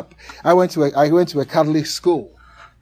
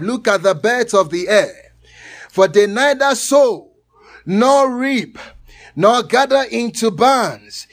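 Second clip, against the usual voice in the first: scripture reading; 6 semitones higher, 5 dB louder than usual. Acted.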